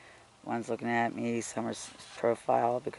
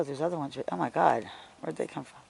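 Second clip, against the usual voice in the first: usual speaking voice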